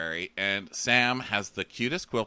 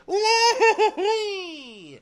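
squeaky drawn-out giggle; high giggle; 8 dB louder, much slower than usual. Retold or imitated. Sam giggling with the queen over stolen books. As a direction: giggle like a naughty child. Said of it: "they're, like, giggling".

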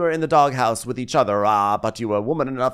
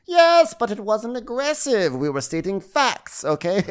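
mock housewife; 10 semitones higher than usual. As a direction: drag it out, mockingly superior. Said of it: "Candace is like".

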